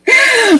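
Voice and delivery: high-pitched burst of laughter; real, high laugh. Real, not acted. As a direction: laugh at your own joke. Host laughs at own joke.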